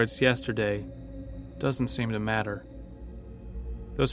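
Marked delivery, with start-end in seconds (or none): none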